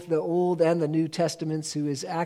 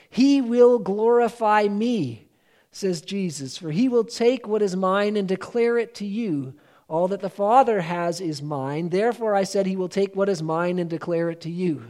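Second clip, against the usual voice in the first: raised, measured Bible reading; scripture reading; 3 semitones higher than usual. Acted. Preacher reads John sixteen aloud to the congregation. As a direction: read Jesus's words with weight.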